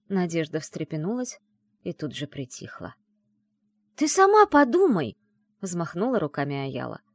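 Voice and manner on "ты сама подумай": high, loud outburst; exasperated urging. A narrator's reading. Friend waving her arms at the heroine, exasperated urging. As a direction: push her to see sense, loudly.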